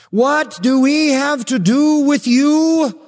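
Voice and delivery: high, loud, slow cry of a cornered demon; panicked demon voice. Acted. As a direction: shout it out like a terrified demon. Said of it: "title by which Jesus was known".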